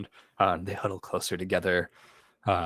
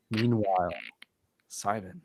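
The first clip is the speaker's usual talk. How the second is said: ordinary talk, nothing marked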